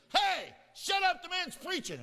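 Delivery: high, loud, furious shout; angry shout. Retold or imitated. He snaps at the girls during the sermon, shouting. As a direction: yell at the girls to shut up. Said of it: "I got so angry".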